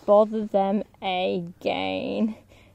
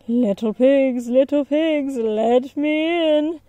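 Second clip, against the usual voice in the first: louder wolf call, slow at the end; 4 semitones higher, 5 dB louder than usual. Acted. Wolf calling at the brick house, coaxing. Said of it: "He called".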